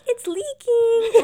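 high, loud, slow drawn-out wail; spoof wail to husband. Retold or imitated. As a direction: echo the movie line at your husband. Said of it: "I'm like".

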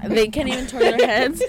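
full-voiced, straight into the microphone; into the mic. Real, not acted. Corrected by a friend, repeating it into the mic. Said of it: "You're not even talking to your mic".

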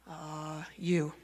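low, very soft, reluctant admission; sheepish answer. Retold or imitated. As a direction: admit it meekly under your breath. Sheepish answer to God's question.

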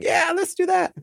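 very high falsetto wife voice; mock wife impersonation. Husband mimics his wife agreeing to coffee, mock falsetto impersonation. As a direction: squeak it in an exaggerated falsetto, poking fun. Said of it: "impersonation of her".

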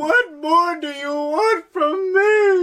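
high, slow, crying mock wail; at wits end. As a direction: wail it like a tragic hero. Joins the mock begging.

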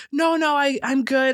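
lightly acted insistent "I'm good"; brushing it off. She waves the friend off.

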